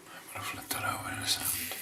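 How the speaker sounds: soft unvoiced aside to himself; quick hushed whisper to the camera. Real, not acted. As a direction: whisper it under your breath mid-shave. Aside while shaving, mic close.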